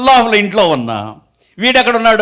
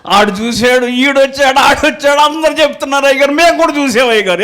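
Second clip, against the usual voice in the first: excited; 8 semitones higher than usual. Retold or imitated. Youths excitedly report seeing him.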